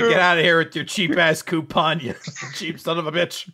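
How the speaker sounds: loud retold brush-off, breaking into laughter; mock angry dismissal. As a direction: playfully chew out a cheapskate. Retold shop worker dismissing customer.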